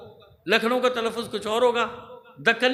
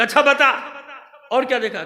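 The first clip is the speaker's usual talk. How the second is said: impatient loud demand; 3 semitones higher, 8 dB louder than usual. Acted. Commander demanding the scout's report, loud demand. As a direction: press him for the rest.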